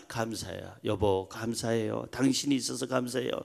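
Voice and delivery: soft, low, gentle gratitude; tender thanks. Acted. Spouse thanking their partner for being there, soft low tenderness. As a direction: speak softly and intimately to a spouse.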